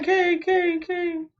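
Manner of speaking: king repeated high and fading each time like an echo. Retold or imitated. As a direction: let king echo away into the distance. Saul's words echo away.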